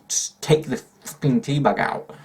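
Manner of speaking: slow, exasperated plea; fed-up rant about tea bags. Real, not acted. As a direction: drag it out in real annoyance. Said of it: "Drives me mad".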